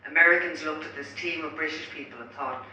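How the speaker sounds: usual speaking voice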